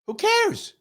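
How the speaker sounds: usual speaking voice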